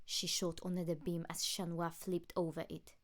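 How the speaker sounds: plain reading voice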